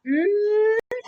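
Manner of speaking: long, high hummed mm; sustained hum demonstration; sounding out with tongue out. Shown as a demonstration. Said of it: "afuera la lengua".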